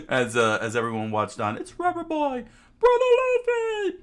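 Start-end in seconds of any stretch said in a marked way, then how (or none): 1.6-4.0 very high loud cheering; excited high cheer. Acted. Villagers cheer the returning hero, very high excited cry. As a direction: cheer him squeakily.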